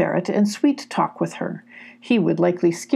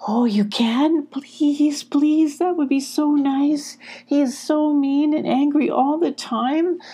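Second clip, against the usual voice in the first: timid ferret; 8 semitones higher, slower than usual. Acted. Ferret pleads with Sylvie.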